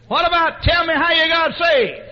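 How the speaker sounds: high, shouted request; yelling across room. Retold or imitated. Friend prompts him across the cafeteria, yelling. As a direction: bellow it over everyone's heads. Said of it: "yelling across the people".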